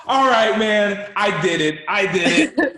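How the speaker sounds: loud, high, laughing through the words; laughing admission. Retold or imitated. Kid caught after buckling seatbelt, laughing confession. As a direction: imitate a kid cracking up as he owns up. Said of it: "laughing like".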